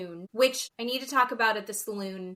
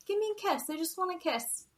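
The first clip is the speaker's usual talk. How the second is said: high, softer coaxing plea; pleading; 8 semitones higher, 4 dB quieter, faster than usual. Retold or imitated. Jasmine to Gino in bed, soft high pleading. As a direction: beg sweetly for a kiss, a little higher and softer. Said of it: "and she's like".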